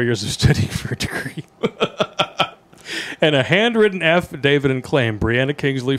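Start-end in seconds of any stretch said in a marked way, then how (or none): none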